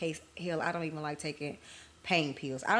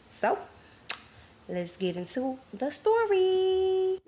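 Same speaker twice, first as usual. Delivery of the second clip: hyped announcement; 5 dB louder, slower than usual. Acted. Host announces story, sing-song.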